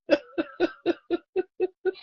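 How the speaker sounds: high squealing laugh; shrill laugh breaking out; high giggle. Real, not acted. Laughs at own joke.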